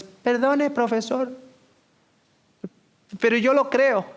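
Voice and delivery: put-on high, firm child voice; bold little boy. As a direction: stand up politely but firmly. Brave boy answers teacher. (niño valiente, voz aguda)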